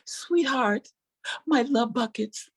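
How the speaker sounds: slow, sugary sweet then rising; sweet tone hiding the insult; sugary mock-tender to her daughter; mock loving mother. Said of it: "my loving mother eyes".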